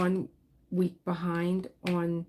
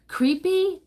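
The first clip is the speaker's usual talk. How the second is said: much higher and louder, incredulous; surprised question; 12 semitones higher, 9 dB louder than usual. Real, not acted. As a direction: react as if the word caught you off guard. Reacting to a viewer comment.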